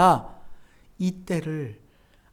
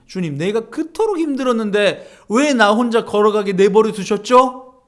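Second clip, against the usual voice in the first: louder, higher aggrieved complaint; complaining to God; 5 semitones higher, 8 dB louder than usual. Retold or imitated. Believer complaining to Jesus about walking alone, aggrieved and louder. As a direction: protest to the Lord like you were abandoned. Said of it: "주님께 불평하는".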